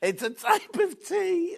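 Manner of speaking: slow, high squeak through laughing tears; real tearful hysterics; helpless laughter. Real, not acted. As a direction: squeeze the words out between giggles. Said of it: "I haven't laughed like that for ages".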